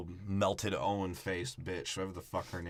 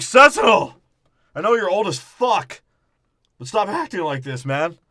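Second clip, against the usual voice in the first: much higher and louder, scolding; loud mock scolding; 9 semitones higher, 11 dB louder than usual. Optimus telling off old Sentinel, loud mock scolding. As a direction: bellow it at a stubborn elder.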